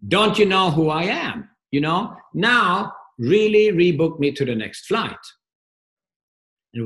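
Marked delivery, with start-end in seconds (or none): 0.1-1.5 raised and higher, an angry passenger pushing for service. Retold or imitated. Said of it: "So he raises his voice and says". 1.7-5.2 loud and higher, demanding; raised voice. Retold or imitated. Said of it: "So he raises his voice and says".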